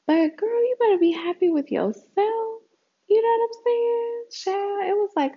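high, sassy, scolding a friend. Real, not acted.

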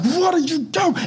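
high-pitched furious yell at a slow driver; enraged driver yelling through the windscreen; road rage yell. Retold or imitated. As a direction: shriek it at the car ahead. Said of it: "I'm like".

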